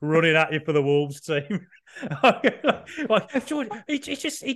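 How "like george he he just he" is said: high, giggling start; laughing. Real, not acted. Mocking George's future as a Wolves defender, laughing. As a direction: laugh as you begin the joke. Laughing at his own joke.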